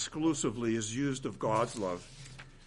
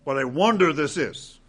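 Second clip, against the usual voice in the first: ordinary talk, nothing marked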